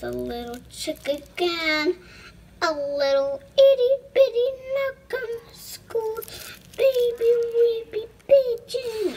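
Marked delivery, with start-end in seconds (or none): 2.6-9.1 put-on cute baby voice. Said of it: "I'm trying to be cute".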